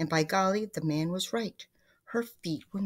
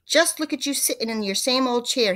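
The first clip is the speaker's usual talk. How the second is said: raised, louder exclamation; crying out; 5 semitones higher, 6 dB louder than usual. A narrator's reading. Mister Twit to his wife in her chair, crying out. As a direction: call it out with a higher, forceful voice. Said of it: "he cried".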